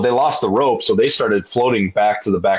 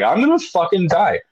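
much higher panicked cry; 9 semitones higher than usual. Retold or imitated. Rower above the sea, high mock panic. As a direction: sure you're about to die. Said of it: "And so I'm like".

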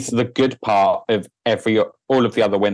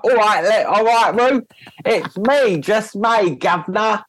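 hammed-up Cockney; 10 semitones higher than usual. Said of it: "I would really ham up, like, a Cockney accent".

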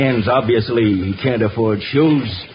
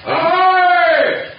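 long, high-pitched hailing call; shouting. Acted. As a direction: hail someone far off, stretching the word. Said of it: "Who are you shouting at?".

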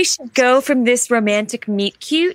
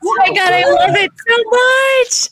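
delighted gush; 9 semitones higher, 3 dB louder than usual. Real, not acted. Loving the new book cover.

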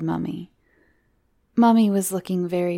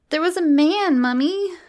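put-on high, louder child voice; insistent little girl; 11 semitones higher, 6 dB louder than usual. Miranda tells Mummy about the man, high and insistent.

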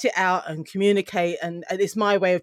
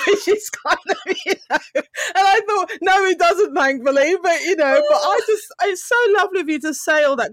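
laughing through tears; choked up; 10 semitones higher, 4 dB louder than usual. Real, not acted. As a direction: voice cracking with real tears. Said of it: "it really did choke me up there".

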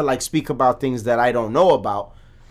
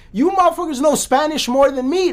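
much higher, heated disbelief; exasperated outburst; 11 semitones higher than usual. Real, not acted. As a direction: throw it at them in disbelief. Exasperated rant at Spanish speakers.

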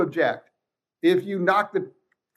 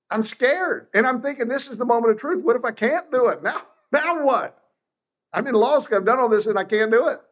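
higher, anxious inner voice; retold nerves; 7 semitones higher than usual. Young law student before first trial, mildly panicky.

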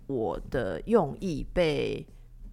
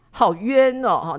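higher and louder, wronged complaint; real sense of being wronged; aggrieved; 5 semitones higher, 8 dB louder, much slower than usual. Real, not acted. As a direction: sound hard done by.

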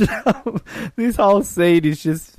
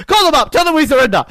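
hammed-up panicking scientist, louder; mock panicked order; 8 semitones higher, 5 dB louder, faster than usual. Podcaster quoting [name] at Blofeld, louder and higher. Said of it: "he's just like".

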